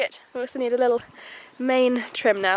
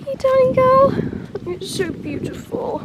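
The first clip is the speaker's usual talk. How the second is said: much higher, slow cooing; slower than usual. Real, not acted. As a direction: adore the mare. Cooing at the mare.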